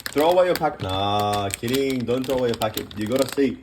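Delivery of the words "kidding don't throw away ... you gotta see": normal talking voice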